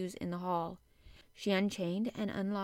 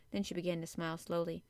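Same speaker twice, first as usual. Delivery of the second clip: usual speaking voice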